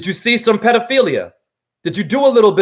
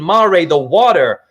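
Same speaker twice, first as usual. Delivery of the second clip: ordinary talk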